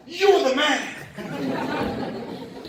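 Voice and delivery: high, loud, very drawn-out mimicked praise; retold amazed praise. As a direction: imitate a stunned stranger hailing his hero. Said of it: "the guy is obviously in shock".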